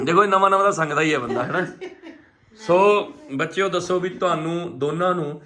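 loud, laughing, teasing aunty voice; dramatic loud aunty. Acted. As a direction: tease the shy couple like a loud aunty. Teasing the shy newlyweds.

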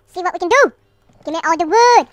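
playful full-volume demand; hammed-up shout. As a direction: shout it with the last word punched up. Repeats it louder, demanding wood.

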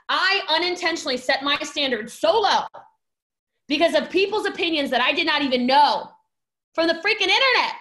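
high, loud punch at each phrase end; forceful, indignant emphasis; emphatic confession. Real, not acted. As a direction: drive the lesson home, building to exasperation. Emphatic lesson, write this down.

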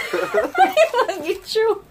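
laughing through the words; giggling while talking. Real, not acted. Laughing at partner mid-game.

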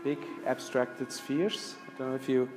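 normal talking voice

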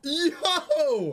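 high laughing yo. Real, not acted. Keeps laughing.

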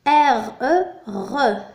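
R E letter by letter, then the syllable "re"; R E as separate letter names, joined into re; spelling aloud. Shown as a demonstration. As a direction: spell out R E slowly, then blend it. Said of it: "نكتب".

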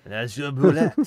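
loud mock Australian accent. Player mangling an Australian accent for a laugh, jokey Aussie accent. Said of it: "best Australian accent I've ever heard".

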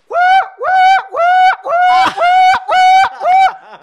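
very high, loud repeated whoops; piercing siren whoops; alarm siren imitation. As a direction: wail like a warning siren.

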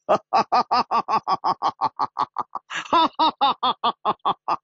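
long high stagey cackle in rapid beats; big laugh. Acted. Winner after throwing triple sixes, laughing big. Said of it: "陆小凤大笑起来".

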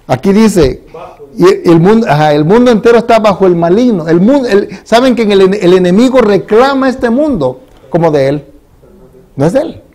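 voz de púlpito, aguda y fuerte; predicación enérgica. Acted. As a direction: proclamar con fuerza ante la iglesia. Leads a sermon. (predicador proclama el texto bíblico con fervor)

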